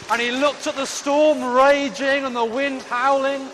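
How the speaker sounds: high, loud, rushing toward the big moment; breathless story climax. A narrator's reading. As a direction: pile it on, all excitement.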